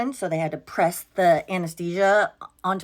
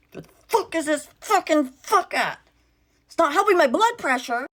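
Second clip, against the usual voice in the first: exasperated doctor; 8 semitones higher than usual. Retold or imitated. Doctor cursing, retold.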